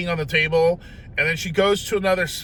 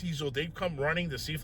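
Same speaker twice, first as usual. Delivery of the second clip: ordinary talk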